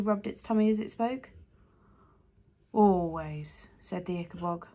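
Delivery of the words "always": slow, lower monster voice; deep creature voice. A narrator's reading. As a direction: say it like an ancient beast.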